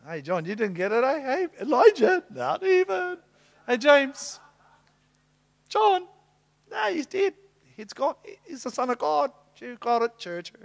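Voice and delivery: high, loud, gloating taunts; boastful Peter. Acted. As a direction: crow over the others like a know-it-all. Peter gloating after the revelation.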